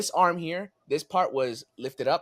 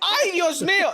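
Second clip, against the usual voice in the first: high, loud Spanish exclamation; alarmed mom; 8 dB louder than usual. Retold or imitated. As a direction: cry out like a startled mother. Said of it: "And then she goes".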